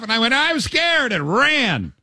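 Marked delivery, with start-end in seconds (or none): 0.3-1.1 high, hammed-up little wail; retold panic, pitched way up; mock frightened kid. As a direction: squeal it like a scared child. Acts out his own childhood fright.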